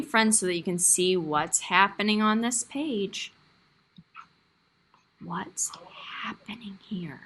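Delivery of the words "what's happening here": whispered prompt. Real, not acted. Teacher prompting quietly.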